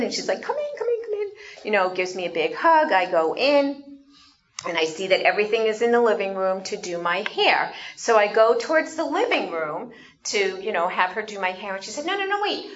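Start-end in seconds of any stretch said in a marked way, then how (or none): 0.4-1.3 high, eager "come in" repeated; retold hostess calling her guest inside; excited welcome. Excited host welcoming her guest in. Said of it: "she's all excited". 12.0-12.6 high quick "no, no, no"; eager stop. Retold or imitated. Hostess eagerly halting her guest, urgent.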